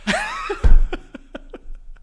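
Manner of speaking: high-pitched burst of laughter; real high laughing fit; laughing hard. Real, not acted. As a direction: crack up, loud and high. Bursts out while telling story.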